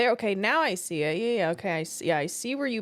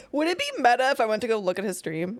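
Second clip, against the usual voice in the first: normal talking voice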